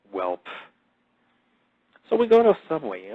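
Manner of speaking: ordinary talk, nothing marked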